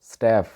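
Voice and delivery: स्टाफ with a flat "æ", staeff; native English pronunciation. Shown as a demonstration. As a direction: say स्टाफ with the vowel of "cat". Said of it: "इसका जो actual pronunciation है".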